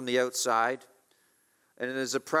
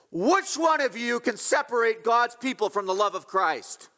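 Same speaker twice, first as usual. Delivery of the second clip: raised, high-pitched challenge to a whole room; ringing challenge; 9 semitones higher, 4 dB louder than usual. Acted. Paul confronts the threats in the room, ringing challenge. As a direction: throw the question down like a dare.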